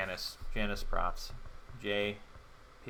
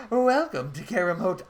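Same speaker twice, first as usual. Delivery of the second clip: higher, louder eager desk clerk voice; a higher, louder put-on clerk greeting; chirpy hotel clerk; 7 semitones higher, 8 dB louder than usual. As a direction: greet her like an over-eager hotel receptionist. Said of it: "I don't know why he talks like this".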